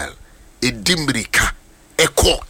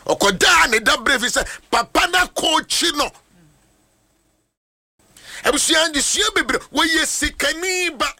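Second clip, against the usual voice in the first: preaching at full force. Acted. Pastor preaching to the congregation, shouting. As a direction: drive the point home, shouting.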